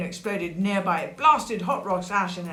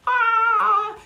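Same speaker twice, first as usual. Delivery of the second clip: high, loud acted "aah"; falling scream; 8 dB louder than usual. A narrator's reading. Sid shouting as he falls into a hole, high loud cry. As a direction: cry out as if tumbling. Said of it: "he shouted".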